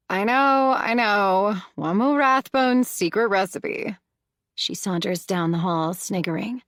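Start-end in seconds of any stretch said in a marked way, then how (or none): 0.1-4.0 put-on higher, louder playful teen voice; teasing. A narrator's reading. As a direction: brush him off with a laugh in it. Teen teasing her uncle.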